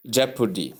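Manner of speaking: "jeopardy" as JEP-uh-dee; correct pronunciation. Shown as a demonstration. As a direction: say "jeopardy" with weak middle vowel. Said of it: "You simply say".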